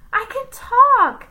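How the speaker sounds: a high, loud, slow joyful shout. A narrator's reading. Jenny shouting that she can talk, high and loud. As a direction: shout it out with delight. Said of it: "shouted Jenny".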